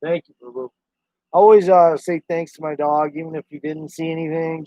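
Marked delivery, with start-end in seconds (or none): none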